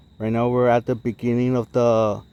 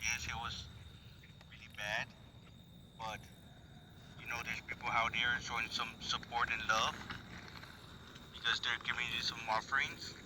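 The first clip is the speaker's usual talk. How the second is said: nervous night vlog whisper; 18 dB quieter than usual. Real, not acted. Soft talk to a spirit.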